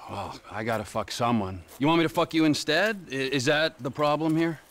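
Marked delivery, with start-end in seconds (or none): none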